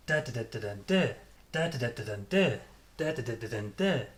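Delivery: "Da, da, da, da, da, da" peaking on the last "da"; strong first "Da", weak middle, strongest last "da"; rhythm syllables. Shown as a demonstration. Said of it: "very strong".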